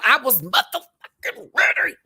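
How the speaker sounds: high, loud squeal of hype; high excited squeal; squealed excitement. Real, not acted. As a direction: squeal it like you can't contain yourself.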